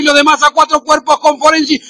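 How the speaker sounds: ordinary talk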